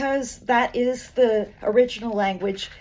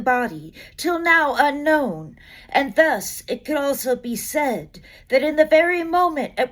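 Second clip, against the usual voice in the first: measured, deliberate poem recital; poetry reading; 4 semitones higher, 3 dB louder than usual. Acted. Reading a Vallejo poem to an audience, measured recital. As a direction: let each image land, building to the closing invocations.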